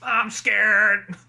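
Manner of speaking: drawn-out character impression; Ned from South Park. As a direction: repeat the buzzing monotone catchphrase. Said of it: "the way he says that".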